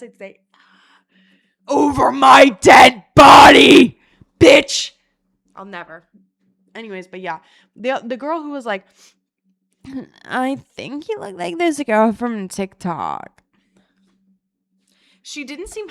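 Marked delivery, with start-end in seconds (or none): 1.7-4.9 high, full-force drawn-out yell; defiant shout. Acted. Cat owner told to get rid of cats, defiant shout. 10.2-13.4 judgy imitation. Imitating the judging girl.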